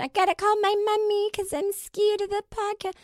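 high mock scared kid voice; whiny little kid. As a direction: pitch it way up like a scared child. Mocking a scared child calling mom.

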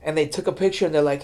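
ordinary delivery

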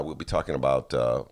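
normal talking voice

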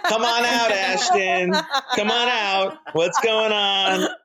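high, excited mock call into the wings; playful calling out. Guest jokingly calls out Ashton, high and playful. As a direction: call out for the hidden prankster, way up high. Jokes it's a Punk prank.

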